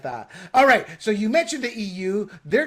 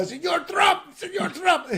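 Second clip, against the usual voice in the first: very high, hammed-up mock Chinese-Panamanian accent; mock China-from-Panama accent; 12 semitones higher, 3 dB louder, slower than usual. China calling to Trump from Panama, mock accent. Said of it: "This is a China from Panama".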